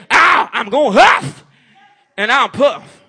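very loud, high threatening wolf; hammed-up devil threat; Satan as the wolf. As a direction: menace the congregation like the devil at the door. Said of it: "he's gonna say".

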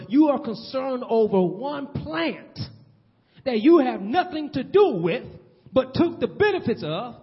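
God pressing the point home; a firm divine scolding; stern God. Acted. God rebukes Jonah.

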